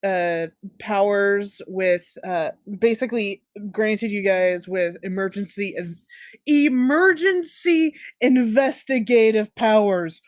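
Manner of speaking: normal talking voice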